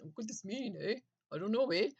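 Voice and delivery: put-on a Canadian accent done as a light tease. Mocking a Canadian conspiracy YouTuber, in a mock Canadian accent. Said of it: "He's a Canadian guy with a Canadian accent".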